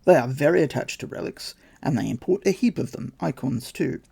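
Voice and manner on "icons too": usual speaking voice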